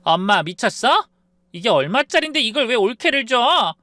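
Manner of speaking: high, loud screeching daughter; shrill outburst. Acted. Spoiled daughter yelling at her mother over a bag, loud and high. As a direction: yell at mom like a spoiled brat. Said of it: "소리를 빽질렀습니다".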